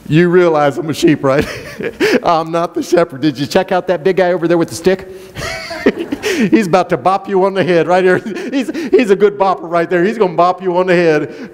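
chuckling through the words, quick and light; laughing joke. Real, not acted. Pastor joking to the congregation, laughing as he talks. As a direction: tease the crowd while laughing.

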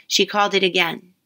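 plain reading voice

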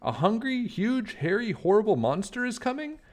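a fox blurting it out before diving away; panicked Fox. Acted.

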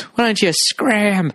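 high, long-drawn comic dismissal; slow, exaggerated old-time heckle; Three Stooges style. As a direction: shoo them away in hammy slapstick style. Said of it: "like fucking Three Stooges".

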